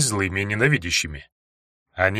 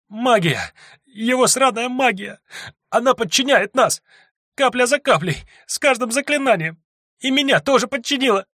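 сквозь рыдания; 12 semitones higher than usual. Acted. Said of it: "Сквозь безутешные рыдания".

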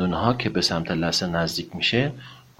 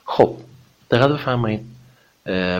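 ordinary talk, nothing marked

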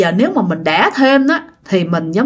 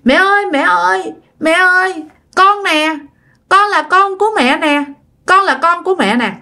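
put-on higher, pleading toddler voice; child voice; 6 semitones higher than usual. Ghost child telling its mother who it is, toddler voice. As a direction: plead like a little kid. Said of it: "mà nói giọng con nít nha".

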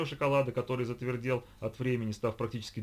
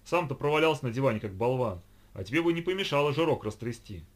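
loud, raised-pitch scolding; irritated retort; 4 semitones higher, 6 dB louder than usual. A narrator's reading. Lida answering Vovka's gibe on the sofa, irritated. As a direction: fire back at the jibe.

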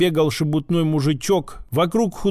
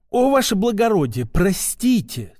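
suddenly obsequious; 3 semitones higher than usual. A narrator's reading. Shopkeeper apologises to the gendarme he grabbed, suddenly obsequious. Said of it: "Тут же переобулся лавочник".

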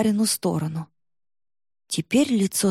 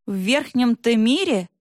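bitter laugh; 3 semitones higher than usual. A narrator's reading. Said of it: "Тата горько засмеялась".

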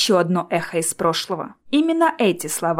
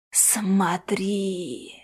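soft, drawn-out ghostly coo; cooing phantom; 5 dB quieter than usual. A narrator's reading. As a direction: coo it softly to lure him. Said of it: "ворковала накрытая капюшоном фигура". (тихое призрачное воркование)

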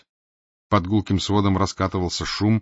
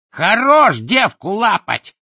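very high, loud, slow jeer; jeering shout; 12 semitones higher, 8 dB louder, slower than usual. Acted. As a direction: yell from the crowd to shame him. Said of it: "крикнул из толпы ... подлый голосишка". (мерзкий выкрик из толпы)

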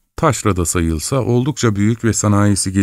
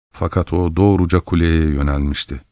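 usual speaking voice

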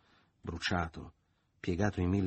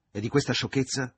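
raised, higher threatening voice; angry threat; 6 semitones higher, 7 dB louder than usual. A narrator's reading. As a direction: threaten him, voice up. Angry man threatens to tell mother. (minaccia irritata)

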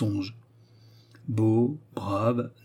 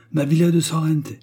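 raised stake; 5 semitones higher, 4 dB louder than usual. Acted. Desperate card stakes.

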